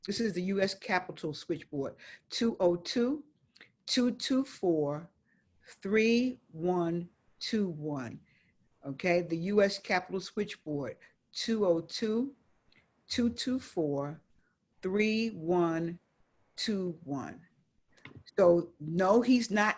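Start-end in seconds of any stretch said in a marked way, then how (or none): 2.3-8.2 slow number dictation with pauses between groups; very slowly. Real, not acted. Giving listeners the switchboard number, slowly. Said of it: "I'm gonna say it very slowly". 11.3-17.4 slow number dictation with pauses between groups; very slowly. Real, not acted. Giving listeners the switchboard number, slowly. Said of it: "I'm gonna say it very slowly".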